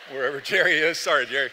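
loud, laughing through the words; laughing apology. Real, not acted. Laughing loudly as he apologises to Jerry. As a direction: apologise while still cracking up.

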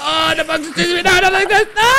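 high, loud comic panic; panicked yelling. Real, not acted. Player chased by a bug cries out to teammates in high, loud panic.

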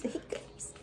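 hiccup sound. Retold or imitated. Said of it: "why you saying it like, like that?".

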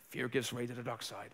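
a hushed hint of Yoda; quiet Star Wars sage, barely acted. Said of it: "Yoda just spoke to me".

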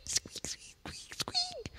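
whispered very high squeaks; mouse squeaks. Acted. Reader voicing the mouse, whispery tiny squeaks.

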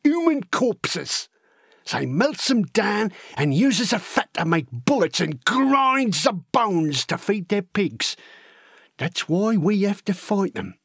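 lively, wide-eyed working-class lad spinning war tales; excitable storytelling with big pitch leaps; eager young Cockney recruit. Acted. Horace telling Elsie gruesome war stories, eager and excitable.

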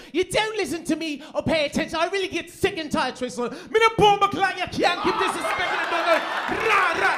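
put-on loud, high ranting Jamaican accent; imitated angry Jamaican wife. Comic imitating his Jamaican-born wife yelling at him in an argument, loud Jamaican rant. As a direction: launch into a furious Jamaican tirade. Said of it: "she just goes in".

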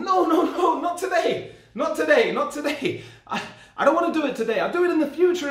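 giggly panicked refusal; laughing protest. Retold or imitated.

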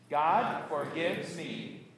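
very slow, deliberate declaration; congregational recitation. Shown as a demonstration. Pastor leading congregation in the good news, slow recitation. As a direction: draw it out so all can join. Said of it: "and say it with me".

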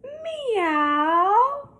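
high, loud cat meow; puppy meows. Acted. Puppy George at the vet, a high meow. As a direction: stretch a kitten's meow. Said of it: "George went".

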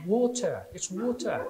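mock-English "water"; imitated English accent. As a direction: correct it with an English "water", no final r. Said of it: "do an English accent".

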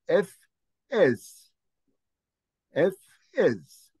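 "F, S" each sound set apart with pauses; separate syllables. Shown as a demonstration. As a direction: spell it out letter by letter. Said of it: "two syllables to be read independently". ("F, S" كل حرف منفصل)

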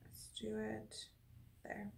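soft whispered self-talk. Real, not acted. Actor setting up her eye line, whispering to herself. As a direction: murmur the setup under your breath. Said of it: "whispering while setting up shot".

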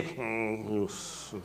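a low, soft judging hum under the breath; disapproving murmur. Retold or imitated. Believer judging a coworker in secret, soft disapproving hum. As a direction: mutter the judgment to yourself. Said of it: "we kinda say".

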